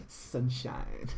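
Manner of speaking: soft, breathed-out, drawn name; sultry whisper. Pretend. Streamer savouring a sexy name for the lady, whispered.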